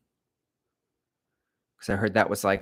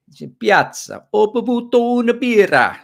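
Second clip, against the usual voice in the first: loud, high hammed-up Italian; mock Italian accent; 13 semitones higher, 9 dB louder than usual. Streamer playfully talking a little Italian, loud mock Italian accent. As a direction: shout it like a cartoon Italian. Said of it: "talk a little Italian".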